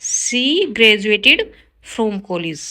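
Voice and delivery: slow, careful read of the corrected sentence; slow teacher dictation. Shown as a demonstration. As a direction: lay out each word clearly for the class. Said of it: "right होगा".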